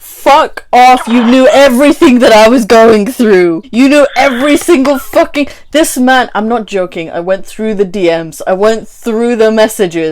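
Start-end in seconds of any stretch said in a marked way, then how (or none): 0.0-6.3 angry. Real, not acted. Angry at ex's lie.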